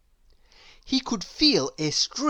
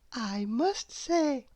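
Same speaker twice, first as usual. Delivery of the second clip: higher, softer and slow; Little Miss Hug praising his smile softly; gentle; 6 semitones higher, 6 dB quieter, slower than usual. A narrator's reading. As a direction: say it tenderly, with delight.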